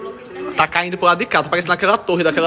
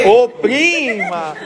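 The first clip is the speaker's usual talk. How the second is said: calling out; 8 semitones higher, 4 dB louder, much slower than usual. Real, not acted. Calling cousin.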